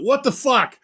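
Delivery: high-pitched retold shocked yell. Recounting a shock at the film, high yelled disbelief. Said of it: "I literally yelled out".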